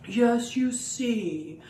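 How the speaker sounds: lightly voiced wise professor owl; a hint of a lecturing owl. Owl puppet sets things straight for friends, lightly wise. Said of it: "Owl is very smart".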